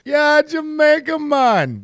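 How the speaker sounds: high mock Jamaican lilt; Jamaican accent. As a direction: say it like a laid-back Jamaican. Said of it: "it's the same impression".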